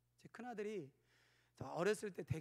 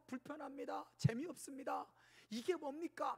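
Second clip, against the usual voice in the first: complaining; 7 semitones higher than usual. Retold or imitated. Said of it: "불평하고 원망할 때".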